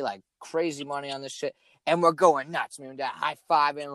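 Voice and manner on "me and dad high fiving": usual speaking voice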